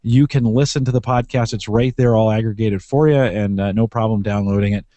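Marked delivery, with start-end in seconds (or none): none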